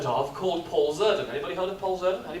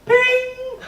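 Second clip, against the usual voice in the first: high, louder chimed "Ping"; ping it like the lift door; lift chime; 7 dB louder than usual. Retold or imitated. Imitating the lift chime in the story. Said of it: "The doors go".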